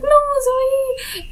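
high-pitched, drawn-out panicked cry; scared cry. Retold or imitated. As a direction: re-enact calling out to a child in fright. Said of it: "I just got so scared".

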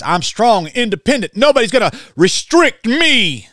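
lightly hammed-up defiant woman; mocking a proud woman. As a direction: sneer as you voice the defiant woman. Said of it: "You Enneagram eights".

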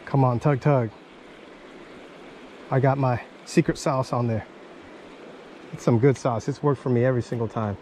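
normal talking voice

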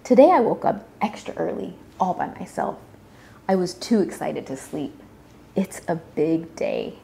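ordinary delivery, nothing marked

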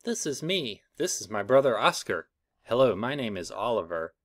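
ordinary delivery

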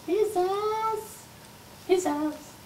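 high, soft echoed tag; echo effect. Acted. Host echoing his own intro, soft and high. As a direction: fade it like a studio echo.

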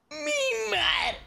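very high, astonished exclamation; asombro exagerado. Real, not acted. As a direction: cry out in shrieking disbelief. Asombrado por las páginas del libro. (grito agudo de asombro)

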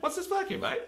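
high squeaky mock voice, laughing; mock suspicious official. Mocking an official eyeing a pirate flag, high laughing voice. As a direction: imitate a nosy official, very high.

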